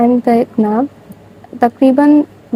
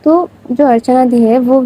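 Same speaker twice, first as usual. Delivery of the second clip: usual speaking voice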